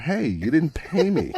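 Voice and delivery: high, slow put-on voice, calling after him; whiny tipster shouting hey at the departing hero; indignant informant. Informant calls after Spider-Man.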